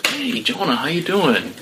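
lightly voiced coach checking in on the pitcher; imitating bullpen coach. Bullpen coach greeting the struggling pitcher, mock cheery voice. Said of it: "the bullpen coach comes around".

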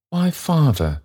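put-on a fond young woman fussing. Meg fussing over the cold child's feet, tenderly.

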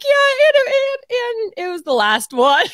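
high, loud, rushed retelling; telling friends, giddy and high; excited. Retold or imitated. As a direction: gush it out in glee. Retelling staff, laughing.

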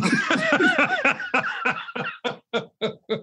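long high-pitched laughing fit; real helpless laughter. Real, not acted. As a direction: crack up, high and wheezing out. Cracking up at caller's mistake.

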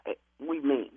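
low-pitched, firm reasoning; calming down. Real, not acted. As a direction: bring the argument down, voice dropped. Tries to cool a shouting match.

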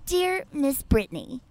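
high, slow, stilted affected voice. Acted. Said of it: "that's a great impression".

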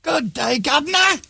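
very high, hammed-up English accent; mock English accent. Answering the English accent request, high hammed-up English accent. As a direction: push a cartoon English accent into falsetto. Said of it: "do your best English accent".

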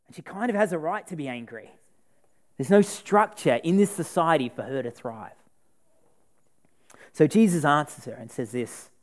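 ordinary delivery

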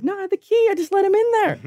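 very high, mocking imitation; comic squeal imitating a coworker; mimicked coworker. Mimics coworker explaining to boss.

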